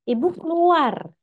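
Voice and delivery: high, loud retold scream of a child. Angry child screams at mother to get out of the room, high shout. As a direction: yell it like an angry child pushing mom out. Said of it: "anak saya teriak jerit".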